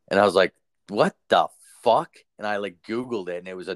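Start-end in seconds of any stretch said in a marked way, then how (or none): 0.9-2.1 slow, louder "what the fuck"; retold disbelief. Telling friends about the creepy poem behind the mirror, retold disbelief. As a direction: draw it out in mock bewilderment. Retelling his shock at the poem.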